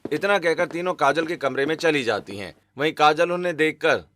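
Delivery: plain reading voice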